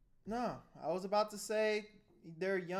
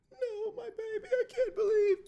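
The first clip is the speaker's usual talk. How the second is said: much higher, hammed-up sobbing wail; mock crying mother; 8 dB louder than usual. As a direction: play the mom breaking down, half-laughing. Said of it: "She's like".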